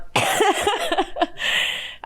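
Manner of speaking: high giggle; giggling. Real, not acted. Vlogger amused by her own Wakanda joke, giggling.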